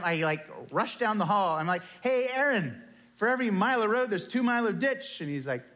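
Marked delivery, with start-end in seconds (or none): 2.0-5.2 bursting to share a great find; enthusiastic. Retold or imitated. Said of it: "So in my enthusiasm".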